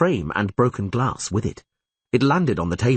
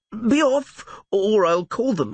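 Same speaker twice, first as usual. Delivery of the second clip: frightened; 14 semitones higher, slower than usual. A narrator's reading. Frightened innkeeper.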